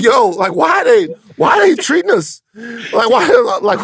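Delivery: high, bewildered pleading; high confused voice. Retold or imitated. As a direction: ask it high and baffled. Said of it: "E T is like".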